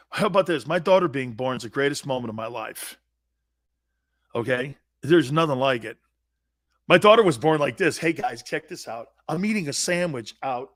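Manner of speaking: ordinary talk, nothing marked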